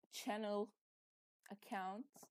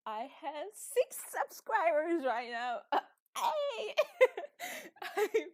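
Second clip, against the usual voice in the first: excited laughing; 7 semitones higher, 6 dB louder than usual. Real, not acted. Finds out subscriber count.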